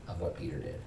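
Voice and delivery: whispered, unvoiced. Real, not acted. Pastor confesses all have done it, whispering. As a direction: let it fade to a hush.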